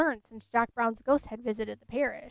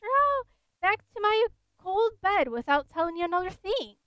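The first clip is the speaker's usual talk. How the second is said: higher, whiny ghostly peevishness; peevish ghost; 7 semitones higher than usual. Acted. The ghost to the hammerman, sulky and peevish. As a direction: sulk like an offended old ghost. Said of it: "peevishly returned the ghost".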